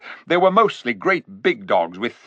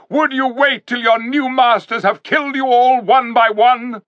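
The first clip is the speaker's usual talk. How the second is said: great ringing cry; 11 semitones higher, 4 dB louder than usual. A narrator's reading. King rallying the Narnians, loud heroic cry. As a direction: call the whole crowd to battle. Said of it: "cried in a great voice".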